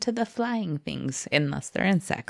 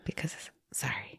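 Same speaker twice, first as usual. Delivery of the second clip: whispered sorry; 5 semitones lower, 12 dB quieter than usual. Real, not acted. Whispered apology.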